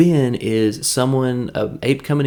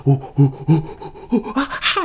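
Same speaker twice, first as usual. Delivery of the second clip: mock monkey hooting climbing much higher; monkey hoots; 5 semitones higher than usual. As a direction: hoot like an excited monkey going crazy. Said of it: "monkey crazy".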